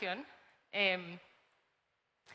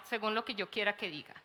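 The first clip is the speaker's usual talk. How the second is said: ordinary delivery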